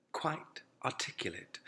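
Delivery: usual speaking voice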